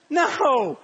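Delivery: normal talking voice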